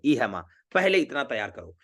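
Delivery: usual speaking voice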